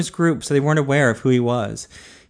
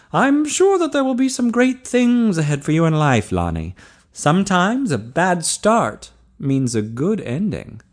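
higher doctor's voice, ending slowly; kindly doctor, encouraging; 6 semitones higher than usual. A narrator's reading. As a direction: reassure the young patient, pitched up, slowing at the end. Doctor encourages the young man.

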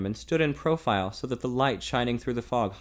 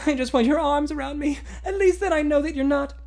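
put-on higher, louder voice of a young woman; heroine's voice; 6 dB louder than usual. A narrator's reading. Heroine pleading with the man she loves, higher and louder.

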